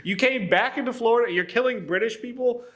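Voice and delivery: high-pitched, incredulous mock outrage; hammed-up indignant protest, half amused; mock fury. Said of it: "the British are furious".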